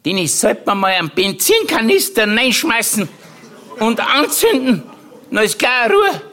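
high, old aunt's mock-earnest voice; Tante Mari. Aunt advising young Dennis in a high voice. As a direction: play the old aunt, matter-of-fact. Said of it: "Da hat sie gesagt, die Tante Mari".

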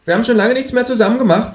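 high-pitched reproachful character voice; reproachful kangaroo. A narrator's reading. Kangaroo to flatmate about doing nothing together, reproachful. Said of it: "in vorwurfsvollem Ton".

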